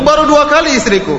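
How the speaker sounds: husband pleading with his ex-wife. Retold or imitated. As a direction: insist gently like a husband begging to reconcile. Kata suaminya.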